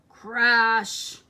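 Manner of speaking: crash. A narrator's reading. Trash mountain breaks, sound word.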